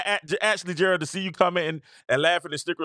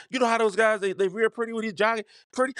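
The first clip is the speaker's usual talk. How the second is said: ordinary talk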